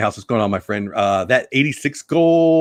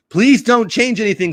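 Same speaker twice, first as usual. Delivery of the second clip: a lightly voiced old fogey pleading, higher and a bit louder; mock fogey plea; 9 semitones higher, 4 dB louder than usual. Teasing Disney Dan as an old fogey, in a mock whine. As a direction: whine it like a stubborn old-timer. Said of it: "said Walt and Dan".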